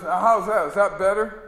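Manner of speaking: hammed-up con man asking if it's better; mock generous straight man. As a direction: sell the smaller bar with a grin.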